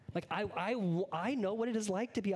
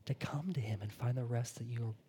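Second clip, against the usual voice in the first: soft, hushed whisper; preacher whispering the call to rest; whispered sermon close; 5 semitones lower, 4 dB quieter than usual. Real, not acted. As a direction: let it fall to a whisper. Closing whispered appeal.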